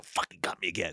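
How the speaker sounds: muttered under breath. Retold or imitated. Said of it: "and you go".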